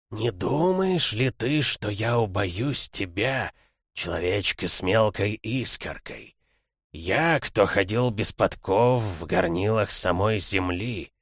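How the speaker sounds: slow, otherworldly creature voice; drawn out like an ancient monster; eldritch horror. Acted. Нечеловеческое существо говорит из тьмы. (медленный голос древнего чудовища)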